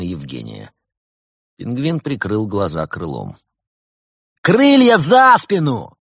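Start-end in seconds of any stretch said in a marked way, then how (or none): 4.4-6.0 roaring, high and loud interrogator bark; barking shout. A narrator's reading. Furious lieutenant shouts at the penguin. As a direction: bark the order like a furious cop. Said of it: "рявкнул лейтенант".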